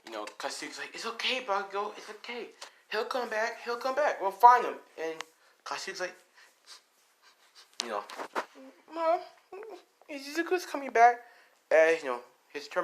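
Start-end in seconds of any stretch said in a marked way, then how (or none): 0.9-4.7 high-pitched acted consoling; urgent reassurance. Consoling someone crying over missing friend, reassuring. 8.9-11.2 very high acted child voice; upset child. Child to mom about missing Izuku, upset.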